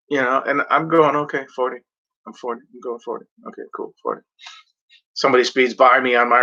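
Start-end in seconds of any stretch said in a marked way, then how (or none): 1.2-4.2 muttering to self. Retold or imitated. Self-talk while driving.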